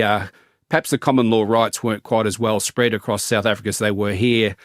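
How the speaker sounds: normal talking voice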